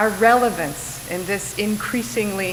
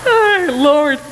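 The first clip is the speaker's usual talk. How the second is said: laughed-out, louder "oh Lord"; laughing; 6 semitones higher, 7 dB louder than usual. Real, not acted. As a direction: still laughing as you say it. Laughing after the dinner story.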